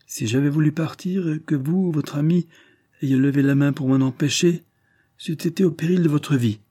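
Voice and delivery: a sad victory in the voice; melancholy and triumphant. Acted. Drishna to Carados, after throwing down his revolver: melancholy triumph. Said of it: "d'une voix mélancolique et triomphante".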